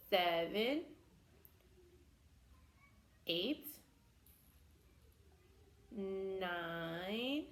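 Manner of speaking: slow drawn-out counting, each number rising; kids show host. Acted. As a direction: count slowly with the children.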